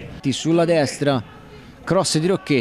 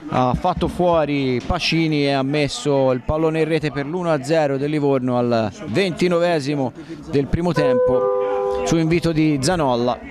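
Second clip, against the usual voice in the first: excited goal call, pitch raised; rising play-by-play over the goal; breathless italian goal commentary; 6 semitones higher than usual. Acted.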